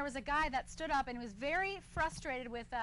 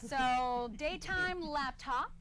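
slow punchline; much slower than usual. Real, not acted.

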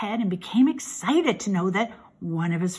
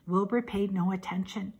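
usual speaking voice

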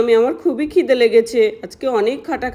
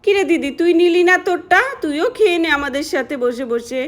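ordinary delivery, nothing marked

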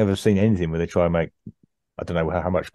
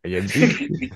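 echoed mock French accent, slightly higher; Gordon Kay's French accent; 4 semitones higher, slower than usual. Co-host echoes the Gordon Kay joke, mock French accent. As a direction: copy the hammy French accent. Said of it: "doing his French accent".

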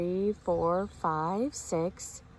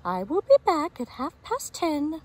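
lightly voiced mother hen, higher; Mama Hen's voice; 7 semitones higher, 4 dB louder than usual. A narrator's reading. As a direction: read as a busy mother hen going out. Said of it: "says Mama Hen".

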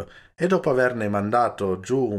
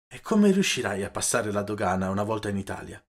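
ordinary delivery, nothing marked

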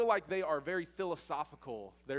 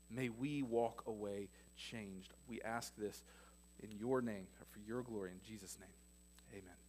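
soft, lower prayer voice; 6 semitones lower, 5 dB quieter than usual. Acted. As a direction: pray quietly and reverently. Opening prayer.

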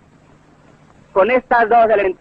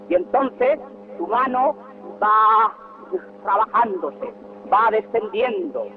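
softer, measured mock-suspense; hushed careful descent; 7 dB quieter than usual. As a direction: drop the volume as if easing in to land. Mimics orator gliding down to land.

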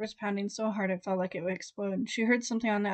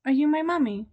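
put-on higher childlike plea; lightly voiced lost boy; a hint of a child's voice; small child; 6 semitones higher, 6 dB louder than usual. A narrator's reading. Ghost child asking.

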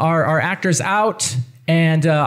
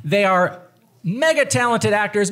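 ordinary talk, nothing marked

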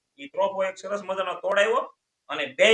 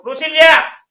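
much higher, much louder yell of the name; angry yell; 9 semitones higher, 16 dB louder than usual. Retold or imitated. Wife yells for her husband to come after years of marriage, fed up and loud. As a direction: bellow it like a fed-up wife across the yard.

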